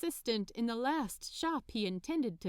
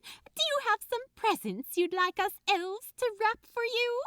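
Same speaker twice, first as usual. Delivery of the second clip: much higher and louder, eager helper voice; enthusiastic elf; 13 semitones higher, 6 dB louder than usual. Acted. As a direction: gush the offer like a delighted elf. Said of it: "losing none of her enthusiasm".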